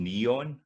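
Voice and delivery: neon with a strong drawn-out "NE", "on" left full; stress on E. Shown as a demonstration. Teacher showing the stressed E, neon drawn out. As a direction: lean slowly on the first vowel of neon. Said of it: "the E has got the stress there".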